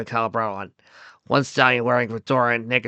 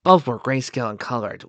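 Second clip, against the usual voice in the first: usual speaking voice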